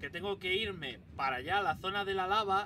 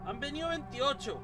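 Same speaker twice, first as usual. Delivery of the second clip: repetición aguda de incredulidad; repítelo sin creértelo, más agudo; 8 semitones higher than usual. Real, not acted. Repite con incredulidad. (repetido con asombro incrédulo)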